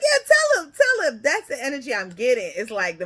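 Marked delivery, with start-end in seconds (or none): 0.0-1.2 put-on higher and louder, cheering a little boy on; proud father. Father urging his little boy to speak up, high and loud. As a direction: hype up your shy son at the counter.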